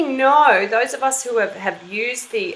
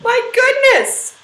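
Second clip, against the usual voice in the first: surprised; 13 semitones higher, 5 dB louder, slower than usual. Acted. Shocked at friend falling.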